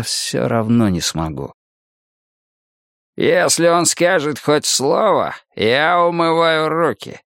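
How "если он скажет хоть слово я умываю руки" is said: put-on slow, higher-pitched old man's grumble; gruffly grumbling old man. A narrator's reading. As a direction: grumble it out slowly as a warning. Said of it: "буркнул старик". (старик бурчит, медленно и с нажимом)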